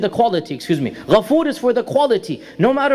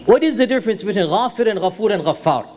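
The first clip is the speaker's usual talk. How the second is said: quick question; faster than usual. Real, not acted. Lecturer first posing the question to the class, quickly. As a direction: rattle the question off briskly. Question posed, then repeated slowly.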